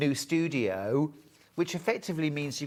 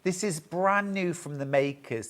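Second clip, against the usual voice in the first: ordinary delivery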